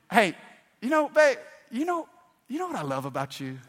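high, coaxing mock sweetness, then quick; butter up your spouse, hammed-up; mock sweet talk. Preacher mimics a husband coaxing his wife, hammed-up sweet talk.

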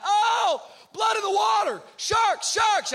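high, loud panicked shouting; freaking out. Retold or imitated. Injured on the beach, yelling in panic about sharks. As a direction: scream it like a drama king. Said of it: "I'm a drama king, so I'm freaking out".

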